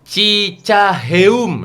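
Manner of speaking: "Cicaheum" drawn out very slowly; slow spelled-out reading. Shown as a demonstration. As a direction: read the name syllable by syllable. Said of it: "Bacanya gimana?".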